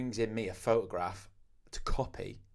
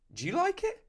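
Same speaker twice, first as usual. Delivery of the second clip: much higher, sing-song question; sweet question; 5 dB louder than usual. Retold or imitated. Tattooist asking a customer about his tattoo, high sing-song. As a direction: lift it up high and innocent. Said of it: "And I always go".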